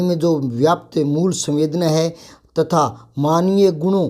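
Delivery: formal teacher-training voice-over; formal lecture narration. Acted. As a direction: read it like an official training narration.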